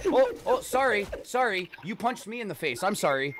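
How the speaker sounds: high, loud, flustered apologizing; mock over-apologetic Canadian. Canadian apologizing to whoever punched him, high hammed-up apology. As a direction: gush sorry as if it were your fault.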